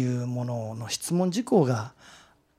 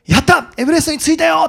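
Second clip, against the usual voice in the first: triumphant high-pitched shout; 歓喜の大声; 13 semitones higher, 8 dB louder, faster than usual. Retold or imitated. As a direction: yell it down the phone, overjoyed. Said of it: "大声で叫んだら". (大声で叫ぶ登頂の喜び)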